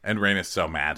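ordinary talk

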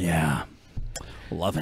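low, flat sarcasm; sarcastic deadpan. Real, not acted. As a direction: say it dead dry. Dry reply to teasing.

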